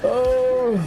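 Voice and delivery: ordinary delivery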